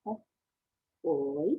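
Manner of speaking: short "P", then a low "oi"; sounding out. Shown as a demonstration. Teacher building the word point, "P" and "oi" separately. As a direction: break point into "P" and "oi". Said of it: "I need my oi sound".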